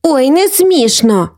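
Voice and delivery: a little sister's pout; offended. A narrator's reading. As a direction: protest slowly, mildly hurt. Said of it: "образилася Софійка".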